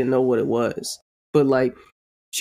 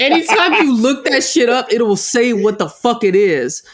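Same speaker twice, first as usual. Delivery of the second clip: loud; 7 semitones higher, 4 dB louder than usual. Real, not acted. Heated point, raised voice.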